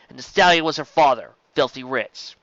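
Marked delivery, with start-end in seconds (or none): none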